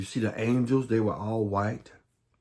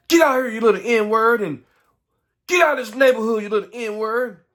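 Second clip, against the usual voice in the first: high, loud threatening shout; furious yell; 12 semitones higher, 8 dB louder than usual. Retold or imitated. Men screaming a boy out of their neighborhood, furious. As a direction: chase him off with a roar.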